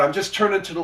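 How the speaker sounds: normal talking voice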